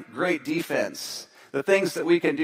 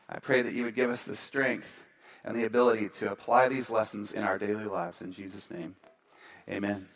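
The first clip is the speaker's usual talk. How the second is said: soft, lower prayer voice; softer earnest prayer; 4 semitones lower, 6 dB quieter than usual. Acted. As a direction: pray quietly and sincerely. Pastor prays before message.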